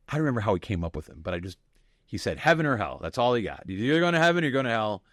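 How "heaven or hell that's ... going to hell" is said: higher, louder mock sermon voice; hammed-up Baptist preacher laying down the law; preaching chaplain. Chaplain preaching to the class, hammed-up fervent.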